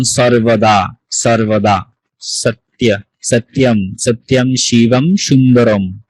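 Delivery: সর্বদা and সত্য with a sharp s, never sh; dental s pronunciation. Shown as a demonstration. Teacher gives Sanskrit examples, সত্য as "sat-ya" with dental s. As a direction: model সর্বদা, সত্যম syllable by syllable with dental s. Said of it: "Bangla চ এর মতো উচ্চারিত হয়".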